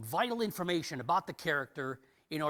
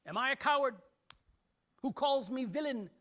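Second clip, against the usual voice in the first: high, raised soliloquy challenge to the audience; Shakespearean character's questioning; 9 semitones higher, 4 dB louder than usual. Acted. Character asks the audience a question in a soliloquy, high and challenging. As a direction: put the questions to the house, pitched up and louder. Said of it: "the character actually asks the audience a question".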